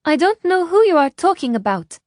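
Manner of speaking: put-on high, loud southern-accented denial; strong southern accent. A narrator's reading. Ishrak pretends to the stranger, strong southern accent. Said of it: "in the strong accent of a woman from the south".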